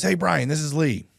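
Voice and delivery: high, slow put-on voice of a caller; Lee impression. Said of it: "not the best Lee impression".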